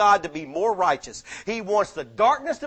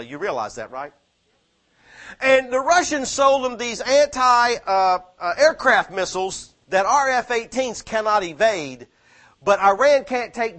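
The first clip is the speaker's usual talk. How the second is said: louder, higher, hammering each phrase; preaching at full force; 5 semitones higher, 6 dB louder than usual. Acted. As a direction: thunder it at the congregation. Urgent end-times warning.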